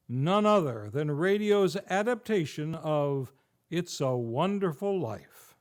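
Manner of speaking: ordinary talk, nothing marked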